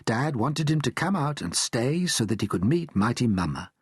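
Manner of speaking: usual speaking voice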